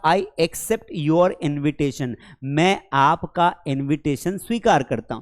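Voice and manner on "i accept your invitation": slow example. Shown as a demonstration. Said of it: "जैसे मैंने कहा".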